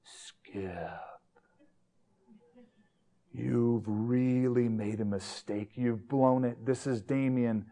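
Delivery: slow, menacing phone-caller voice; low threatening voice. Retold or imitated. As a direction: imitate a sinister caller, drawn out and low. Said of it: "with a real low voice, you know, threatening voice".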